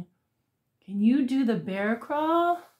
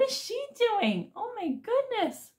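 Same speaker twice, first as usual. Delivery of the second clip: excited gasp; 10 semitones higher, 4 dB quieter than usual. Acted. Excited reaction to child on video.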